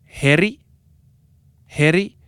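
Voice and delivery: teaching pronunciation of "Heri". Shown as a demonstration. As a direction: sound out "Heri" for the class. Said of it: "can you say Heri".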